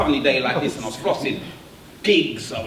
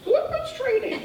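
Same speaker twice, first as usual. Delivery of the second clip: incredulous. Retold or imitated. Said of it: "I was like".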